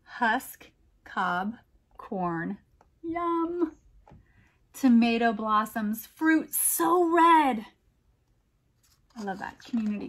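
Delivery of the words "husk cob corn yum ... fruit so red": slow, lilting picture-book reading; poem read aloud. A narrator's reading. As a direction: read it like a bedtime rhyme for kids.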